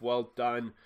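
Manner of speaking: ordinary talk, nothing marked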